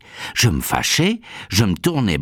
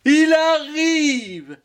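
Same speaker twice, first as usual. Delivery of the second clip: very high, long-held announcement; call from higher up; much slower than usual. Acted. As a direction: relay the news upstairs, stretched and shrill. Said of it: "dit un autre encore un peu plus haut". (appel suraigu, très étiré)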